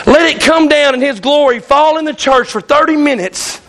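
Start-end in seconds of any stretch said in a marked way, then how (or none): none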